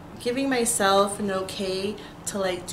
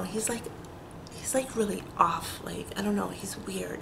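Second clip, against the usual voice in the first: whispering; 8 dB quieter than usual. Real, not acted. Whispering gossip about neighbor.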